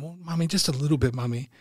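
put-on high, quick kid's confession; sheepish child. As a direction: imitate a child owning up to mom. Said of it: "it's me as a child".

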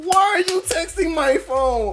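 high, slow, drawn-out question; incredulous. Retold or imitated. Man questioning a stranger texting his phone, incredulous. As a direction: whine it in exaggerated disbelief.

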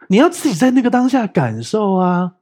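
usual speaking voice

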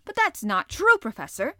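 loud, high burst of protest; the harpooner contradicting the professor. Acted. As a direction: refuse to back down, loudly. Ned Land voiced.

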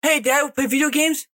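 put-on lightly high child's ask; a hint of an eager little kid. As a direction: kid asking dad to play.

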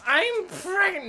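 high, loud, drawn-out fake news reveal; hammed-up excited squeal; mock joyful announcement. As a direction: shriek the pretend news, stretching it out. Joke announcement, retracted right after.

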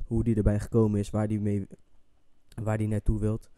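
ordinary talk, nothing marked

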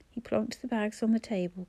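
ordinary delivery, nothing marked

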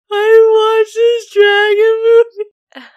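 high, loud, slow wailing sob; mock sobbing. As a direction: wail it out like a crying kid. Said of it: "She's like".

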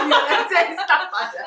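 high, laughing echo of a joke. Real, not acted. Friend echoes a joke about fighting back, laughing.

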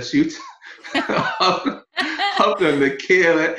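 laughing through the words. Real, not acted. Laughing while recalling trip.

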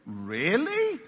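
low start swooping up into a question; feigned surprise. Acted. Jesus hears that he is dead, feigned surprise. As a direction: play innocent, drawing the word upward.

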